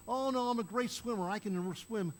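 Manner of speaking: high, brash brush-off; cocky refusal. Retold or imitated.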